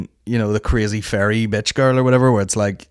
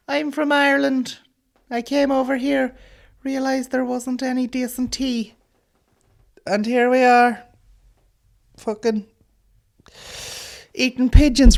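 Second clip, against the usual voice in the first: pigeon lady voice; 14 semitones higher than usual. Acted. Comic telling the audience, as a high batty pigeon lady. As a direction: pitch it way up and drag it out like a batty bird woman.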